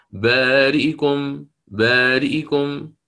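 بارئكم read slowly with the hamza's kasra snatched short. Shown as a demonstration. Teacher demonstrating the snatched kasra. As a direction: say بارئكم and just touch the kasra. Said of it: "اختلاس كسرتها".

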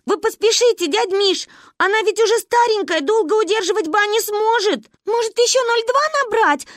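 put-on high, loud, panicky little-girl voice; urgent child's plea. A narrator's reading. Girl begs Uncle Misha on phone, high urgent plea. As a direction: urge him to hurry, all in alarm. Frantic children phoning for help.

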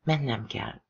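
hushed, dropping the voice on "Mennem kell"; soft whisper. A narrator's reading. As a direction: breathe "Mennem kell" so no one outside hears.

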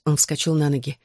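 plain reading voice

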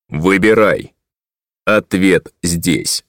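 slow metallic voice; booming metallic robot. A narrator's reading. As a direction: speak like a metal machine. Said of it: "прозвучал над ухом металлический голос".

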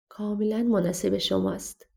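usual speaking voice

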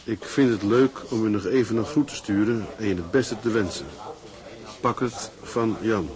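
letter reading. Acted. Husband reading his farewell card to his wife. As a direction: read the postcard aloud tenderly.

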